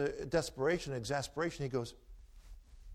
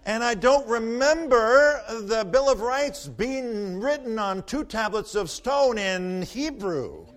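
laughing punchline; 10 semitones higher, 13 dB louder, slower than usual. Real, not acted. Punchline over crowd laughter.

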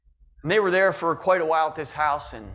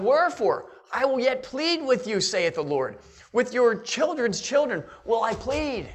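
scripture reading; 5 semitones higher, slower than usual. Acted. Reading the verse aloud.

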